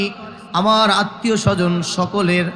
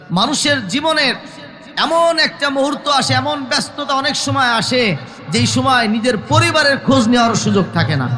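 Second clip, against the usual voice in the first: high-pitched, urgent sermon delivery; forceful preaching; 6 semitones higher than usual. Real, not acted. Preacher warning congregation about busy lives, high impassioned preaching.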